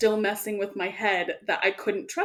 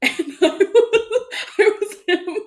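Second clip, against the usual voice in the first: high and loud, laughing through the words; 12 semitones higher, 7 dB louder than usual. Real, not acted. Friend recounting playing the villain, laughing. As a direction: laugh while you say it.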